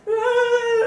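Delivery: high, loud comic bawl; imitated sobbing wail, hammed up; mock crying wail. Crying mother answering her laughing daughter, mock high wail.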